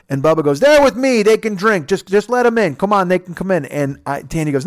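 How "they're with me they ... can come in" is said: high, loud imitation of an arguing drunk; drunken protesting. Bubba arguing with the security guard, loud protest. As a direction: start at a holler, ease down as you plead.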